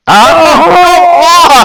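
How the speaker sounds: long, high-pitched wail of "oh"; dismayed outcry. Real, not acted. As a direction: cry out in real dismay at the bad roll. Reacting to a terrible dice roll.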